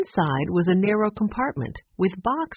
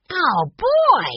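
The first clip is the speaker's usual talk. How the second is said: put-on high, louder boyish exclamation; excited cry; 12 semitones higher, 5 dB louder than usual. A narrator's reading. Little boy seeing the peacock, high excited cry. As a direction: burst out in childish delight. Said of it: "Benny cried".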